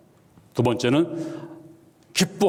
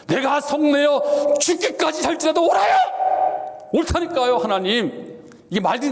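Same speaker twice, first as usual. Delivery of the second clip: angry shout at God; 9 semitones higher than usual. Acted. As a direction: yell it like a sulking prophet. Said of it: "하면서 막 화를 내는데". (하나님께 높고 크게 소리치며 화내는 요나)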